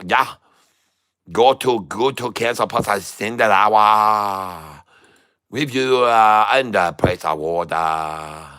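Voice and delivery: put-on broken-English trader in a foreign accent. Plays a foreign trader character.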